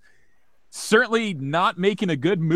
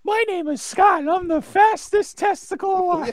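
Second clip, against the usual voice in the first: much higher, louder cartoon hero voice; squeaky cartoon hero; 7 dB louder than usual. Mocking a superhero intro, high squeaky cartoon voice. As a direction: chirp it like a Saturday-morning cartoon.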